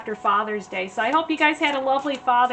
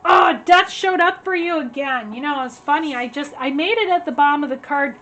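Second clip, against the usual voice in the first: ordinary delivery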